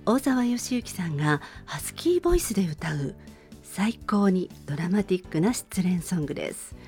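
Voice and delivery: ordinary talk, nothing marked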